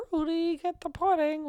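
mock comedian voice, high and slow; Bill Cosby. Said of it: "make fun of Bill Cosby".

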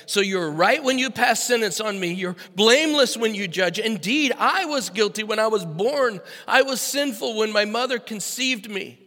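loud, rising psalm read from the pulpit; forceful scripture reading. Acted. Pastor reading David's psalm to church, loud and forceful. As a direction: proclaim the confession loudly.

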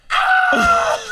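high piercing imitated scream of panicked passengers; hammed-up plane-crash scream; mock terrified scream. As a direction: shriek like the plane is falling.

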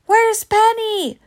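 high, loud and slow alarmed cry; panicked shout. A narrator's reading. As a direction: demand it in panic. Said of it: "I heard him yell".

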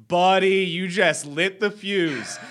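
mock warning, loud and drawn out; exasperated retort. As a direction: call out the buddy with disbelief. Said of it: "I was like".

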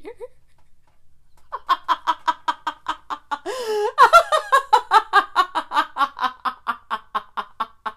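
high long cackling laugh; loud laughing fit. Real, not acted. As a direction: break into helpless high laughter. Long laughing fit after the blurb.

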